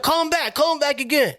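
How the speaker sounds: high put-on voice of a frantic kidnapper; pushing his partner to redial, voiced high; urgent mock plea. Kidnapper urging his partner to call back, high mock voice. Dude urging in movie retelling.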